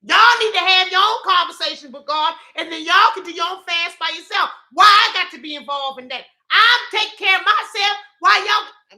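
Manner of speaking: loud, high, indignant rant; upset. Real, not acted. Speaker scolding followers for needing help, upset and indignant. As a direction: let exasperation spill over comically. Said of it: "I'm getting upset now".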